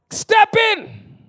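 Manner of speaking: very high, loud shouted plea; piercing prayer shout; crying out to God. Retold or imitated. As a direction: cry out at the top of the voice for divine help. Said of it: "cry out to God".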